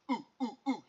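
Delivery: hushed high ooh-ooh; soft cooing ooh. Acted. Father peering into Andrew's mouth, hushed ooh. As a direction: coo softly over the tooth.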